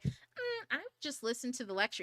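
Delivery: a hint of a laid-back husband; relaxed, easygoing husband voice; chill husband. Wife imitating her chill husband's advice, relaxed. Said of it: "He says it in such a".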